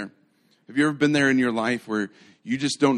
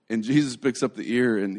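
ordinary talk

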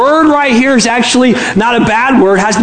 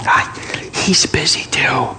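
small whisper; 6 semitones lower, 7 dB quieter than usual. Acted. Child covering for father on phone, whispering. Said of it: "Still whispering, the voice said".

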